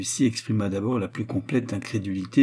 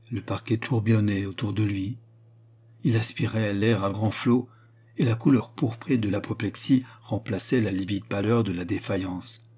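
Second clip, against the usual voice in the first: plain reading voice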